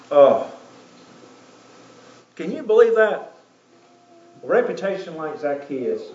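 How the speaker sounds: ordinary delivery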